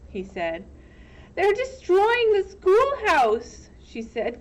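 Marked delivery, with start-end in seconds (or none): 1.4-3.7 out of breath. Acted. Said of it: "gasping for breath".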